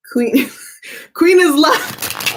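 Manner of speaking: drawn-out, high laughing reading of the name; laughing slowly at a viewer's name. Real, not acted. Greeting a startling viewer.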